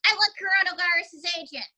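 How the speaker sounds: high-pitched puppet voice; May. Acted. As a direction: pipe up as a sassy puppet character. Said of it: "what did you say to me, May".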